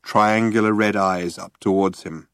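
usual speaking voice

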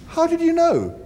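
high, startled; shocked question; surprised. Acted.

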